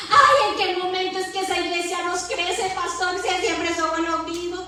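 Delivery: rising pulpit exhortation; fervent preaching. Acted. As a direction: preach it to the congregation with fire. Preaching at full force.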